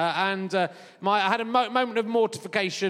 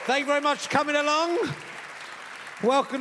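calling out; 7 semitones higher than usual. Real, not acted. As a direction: project the hello out to the room. Greeting the Edinburgh audience.

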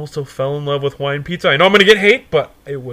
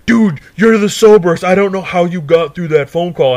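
loud, higher-pitched buddy voice; imitated stoned pleading; 4 semitones higher, 6 dB louder than usual. Stoned friends pushing him to answer the door, loud urging. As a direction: push it out loud and high. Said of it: "they're like".

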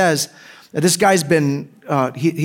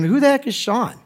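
higher-pitched mock bafflement; incredulous question; 7 semitones higher than usual. Conference audience reacting to an unknown speaker, mock incredulous.

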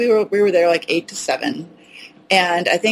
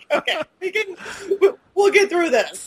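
high, laughing, soothing; calm her down while laughing; laughing reassurance; 11 semitones higher than usual. Retold or imitated. Vet laughing while calming panicked owner, much higher. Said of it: "I was like".

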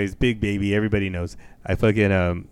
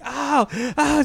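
high-pitched screamed "ow"; hammed-up yelp at a needle; mock screaming. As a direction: cry out in exaggerated pain, voice way up. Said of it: "I start screaming".

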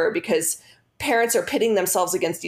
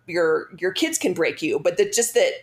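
ordinary talk, nothing marked

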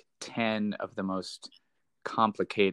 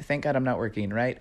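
ordinary delivery

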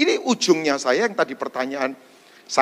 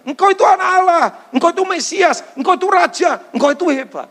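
much higher, louder voice, fawning praise; Peter's flattery; 12 semitones higher, 4 dB louder than usual. Acted. Peter flattering Jesus to his face, high fawning voice. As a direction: gush praise up high like an eager disciple. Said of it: "Ini orang yang barusan berkata".

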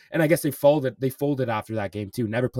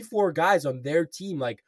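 normal talking voice

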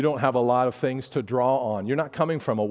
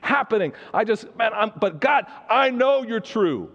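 fervent prayer; 7 semitones higher than usual. Acted. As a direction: pray with rising passion, voice lifting high. Fervent prayer rising to a cry.

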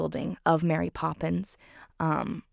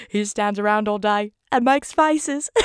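higher, louder, lightly voiced Bert; Bert's punchline; 4 semitones higher, 5 dB louder than usual. Bert answering Uncle Albert's question, a cheeky punchline. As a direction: land the punchline with relish.

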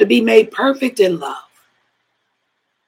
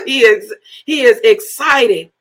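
normal talking voice

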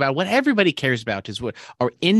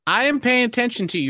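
much higher, defensive; defensive protest; 12 semitones higher than usual. Real, not acted. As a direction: protest your innocence indignantly. Defending himself against the accusation.

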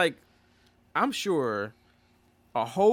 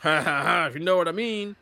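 mock male banter; 3 semitones higher, slower than usual. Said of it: "they're probably just thinking".

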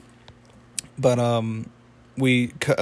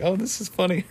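high, amused naive voice; Pops; 7 semitones higher than usual. Retold or imitated. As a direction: brush it off lightly, pitched way up. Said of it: "Pops just laughs it off".

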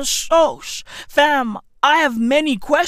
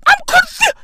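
high-pitched; faster than usual. Acted. Comic rant, voice jumps up.

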